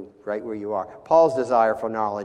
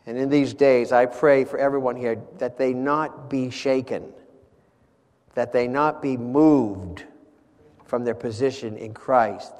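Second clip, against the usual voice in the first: fervent prayer; 3 semitones higher, 4 dB louder than usual. Real, not acted. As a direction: pray for them with mounting force. Leads congregation in closing prayer.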